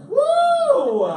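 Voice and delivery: very high, loud whoop; joyful cheer. Real, not acted. Reader cheering at the rhyming book. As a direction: whoop with delight. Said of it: "cheer at rhyme".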